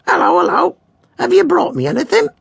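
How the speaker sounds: very high, squawking bird voice; squawky parrot. A narrator's reading. Parrot greeting Henry's cat, high squawk. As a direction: screech it out like a nosy parrot.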